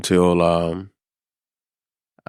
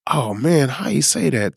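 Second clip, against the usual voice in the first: higher, stunned disbelief; shocked staff; 8 semitones higher than usual. Retold or imitated. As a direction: imitate the staff gasping at the news.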